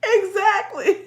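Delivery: high, loud burst amid laughter; delighted agreement. Real, not acted. Host agreeing with viewer's gardening joke, loudly delighted. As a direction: shout it in total agreement.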